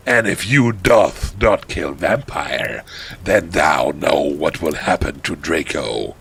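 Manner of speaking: low, slow ominous drawl; mock-menacing archaic villain; villain threat. Voldemort warning the heroine about Draco, low menace.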